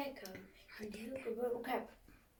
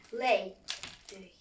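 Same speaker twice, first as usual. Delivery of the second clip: command to hen; 10 dB louder than usual. Acted. Jack orders the hen.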